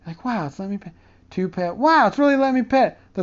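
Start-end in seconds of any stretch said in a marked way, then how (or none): 0.2-0.9 soft, held-breath wonder; quietly thrilled not to scare the cat; hushed amazement. Retold or imitated. Telling about petting mom's cat, softly amazed. 1.8-3.0 building joy as the cat allows a second pet; delighted amazement. Retold or imitated. Telling about petting mom's cat, mock delighted.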